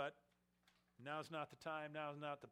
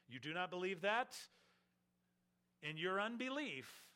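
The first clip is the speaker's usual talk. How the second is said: higher, louder challenge; challenging; 4 semitones higher, 5 dB louder than usual. Acted. Preacher confronting unbelieving listeners, raised and pointed. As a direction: fling the question at the hearers.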